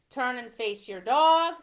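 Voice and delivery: ordinary talk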